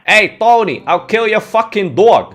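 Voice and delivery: loud, high mock Boston tough guy. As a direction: ham up a Boston accent. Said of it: "stereotypical and basic Boston accent".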